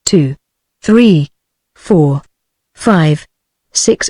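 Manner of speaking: measured count, each number on its own; slow counting. A narrator's reading. He counts to himself to steady his heart, slowly.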